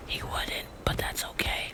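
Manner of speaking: snide whispered aside. Real, not acted. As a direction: mutter it under your breath, snide. Snide aside under breath.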